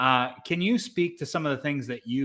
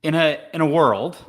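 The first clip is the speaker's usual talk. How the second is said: booming trailer announcer voice, higher and louder; movie trailer voice; 5 semitones higher, 8 dB louder than usual. Shown as a demonstration. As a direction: open like an epic movie trailer. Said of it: "that's my trailer voice".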